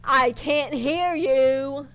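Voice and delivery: loud, slow mocking shriek. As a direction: shriek it at him slowly. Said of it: "Orlum shrieks".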